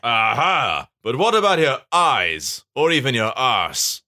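hammed-up baddie gotcha; baddest bee brother. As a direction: pounce with mock-menacing emphasis. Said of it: "the baddie baddest bee brother".

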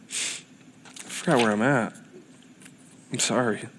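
low, soft murmur to oneself; quiet aside. Real, not acted. Preacher losing the place in the notes, quietly apologetic.

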